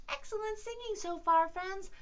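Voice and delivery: high, quick cheerful praise; excited praise. Acted. Teacher praising the class in a high voice. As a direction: cheer the kids on brightly. Praising kids after the song.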